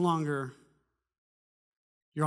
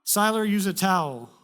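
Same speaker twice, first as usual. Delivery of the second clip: curt, louder wife telling him off; flat-out correct your husband; matter-of-fact wife tone; 6 semitones higher, 9 dB louder than usual. Retold or imitated. Wife scolding husband about towel, curt tone. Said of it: "the tone says dummy".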